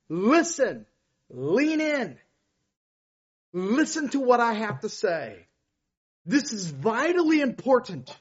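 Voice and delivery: high, loud earnest plea to lean in; urgent appeal. Shown as a demonstration. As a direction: draw the crowd in, urgently. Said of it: "But Jesus is saying".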